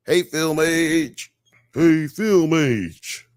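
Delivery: slow, high Southern drawl; Southern accent. Acted. Host greeting a commenter, slow Southern drawl. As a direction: stretch the greeting in a Southern twang. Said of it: "all of your Southern accents".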